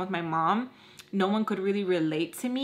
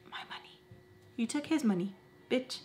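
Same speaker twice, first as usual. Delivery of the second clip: breathy whispered insult; whispered retort; 3 semitones higher, 8 dB quieter than usual. Retold or imitated. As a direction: hiss it under your breath. Unvoiced whispered inner retort.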